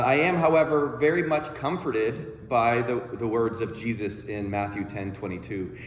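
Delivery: ordinary talk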